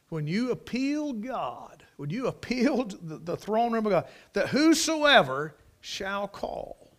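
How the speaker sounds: high, ringing pulpit delivery; impassioned preaching. Acted. Preacher urging listeners to call on God, impassioned.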